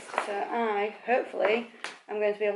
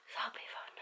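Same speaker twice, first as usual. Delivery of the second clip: whispering; 13 dB quieter than usual. Real, not acted. Whispering while family home.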